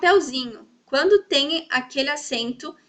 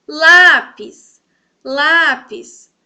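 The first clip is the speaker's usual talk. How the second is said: LÁpis, first syllable long and much louder; stress on LÁ; 2 semitones higher, 11 dB louder, much slower than usual. Shown as a demonstration. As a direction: call out LÁpis, leaning hard on LÁ. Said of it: "se eu falar também eu vou saber".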